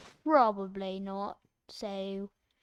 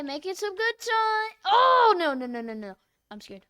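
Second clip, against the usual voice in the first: ordinary talk, nothing marked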